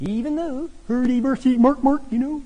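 high, loud mock Swedish Chef gibberish; hurdy-burdy it like the Muppet chef; Swedish Chef impression. Preacher to congregation, joking as the Swedish Chef. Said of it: "The Swedish chef, my hero".

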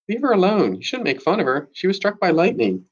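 high, blurted outburst; Wendy snapping to defend Lucy, blurted high. A narrator's reading. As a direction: blurt it out before thinking. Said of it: "Wendy blurts out".

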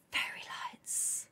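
slow, hushed whisper; barely voiced, drawn-out whisper; awed whisper. Real, not acted. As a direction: whisper it slowly in wonder. Awed whisper after gasp.

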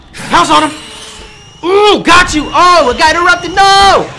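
high, loud frantic yelling. Real, not acted. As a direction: yell it out in panic.

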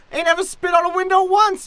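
high, loud, quick denial; indignant protest. Acted. Long-serving servant denying spitting on the window, indignant. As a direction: protest fast and high, stung by the accusation. Servant defends self against accusation.

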